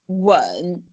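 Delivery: "wen" nodded down, then up; low falling-then-rising "wen"; dipping third tone. Shown as a demonstration. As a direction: dip "wen" low and lift it at the end. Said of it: "nod it down and then you nod it up".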